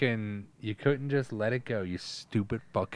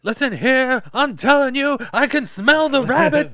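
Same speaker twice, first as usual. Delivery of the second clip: much higher, louder cartoon villain voice; over-the-top high villain; Judge Doom; 12 dB louder than usual. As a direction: ham up a menacing villain, pitched way up. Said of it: "Judge Doom".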